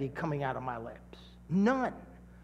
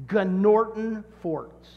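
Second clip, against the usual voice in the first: very slow and louder, every syllable spelled out; slow emphatic demo; 4 dB louder, much slower than usual. Shown as a demonstration. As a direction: present the word slowly to the congregation. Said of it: "The word is".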